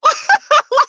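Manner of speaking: squealing laugh; high laugh. Real, not acted. Vlogger laughing while retelling the call with her mother.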